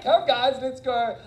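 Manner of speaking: rally reluctant helpers to the garden; cheerful team rally. Retold or imitated. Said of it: "So it was like".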